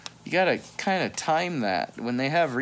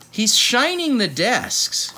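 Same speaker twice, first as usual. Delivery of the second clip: delighted announcement; 10 semitones higher, 7 dB louder, slower than usual. Acted. Delighted reading of picture.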